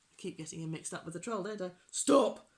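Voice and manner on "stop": loud, raised pirate order; called out. A narrator's reading. Pirate chief stops the crew, shouting. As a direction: shout a halt. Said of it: "called Hank Chief".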